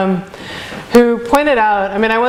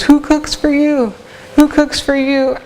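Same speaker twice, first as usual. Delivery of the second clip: high, slow hooting "Who cooks for you?" with the pitch up; playful owl hoot, "Who cooks for you?" high and slow; owl call imitation; 6 semitones higher than usual. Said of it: "he says".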